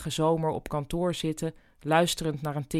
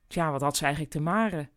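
plain reading voice

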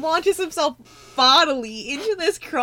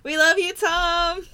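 excited; 6 semitones higher, 4 dB louder than usual. Pretend. Calling out to fan.

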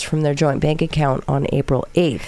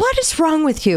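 incredulous; 10 semitones higher than usual. Real, not acted. Co-host shocked at lube joke.

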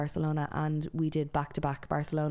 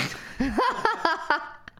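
a high burst of real laughter; 12 semitones higher, 3 dB louder than usual. Real, not acted. Laughing at the Ga joke.

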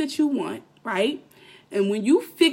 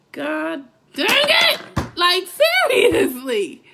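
slow, louder, exasperated exclamation; frustrated outburst; 7 semitones higher, 5 dB louder, slower than usual. Real, not acted. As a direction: let the frustration burst out.

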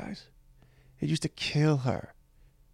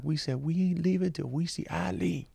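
put-on a light street New York accent, pitched up a little; homeless man's accent; 5 semitones higher, 3 dB quieter than usual. Homeless man insisting he'll wait for Eileen, light New York accent. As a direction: lightly voice the shelter guy's New York twang. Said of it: "a voice that I remember in my head of this one guy".

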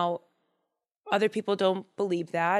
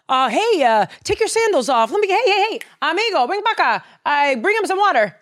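loud, high, over-eager host; J B schmoozing; 9 semitones higher, 6 dB louder than usual. Acted. King suddenly fawns over the man of God, schmoozy. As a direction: gush like a fake friend, pitched way up. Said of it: "he starts being schmoozy".

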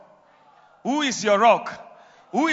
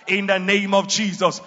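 ordinary delivery

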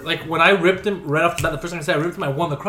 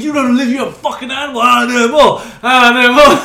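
mock yell; 5 semitones higher, 9 dB louder than usual. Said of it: "He's like".

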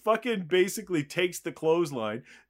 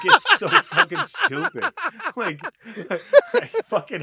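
laughing outburst; 14 semitones higher, 8 dB louder than usual. Real, not acted.

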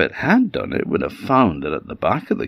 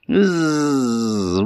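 long, higher-pitched buzz; bumblebee buzz; 6 semitones higher than usual. Acted. Bee answers the scared vixen, buzzing. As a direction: buzz before offering help. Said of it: "said the bee".

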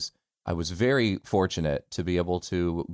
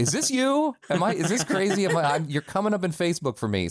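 high-pitched incredulous quote; retold amazement; 10 semitones higher than usual. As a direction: imitate amazed friends, voice jumping way up. Retells friends' amazed messages.

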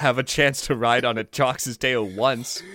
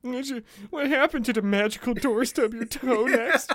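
mocking sufferer; 10 semitones higher than usual. Mocking fairy tale sufferer.

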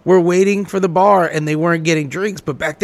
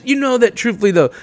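ordinary talk, nothing marked

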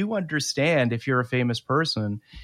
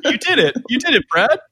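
high, louder mock cheer; mock congratulations; 11 semitones higher, 5 dB louder than usual. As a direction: cheer him on in a raised, teasing voice. Teasing congratulation on the Oscar.

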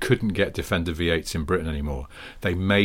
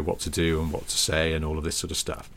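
normal talking voice

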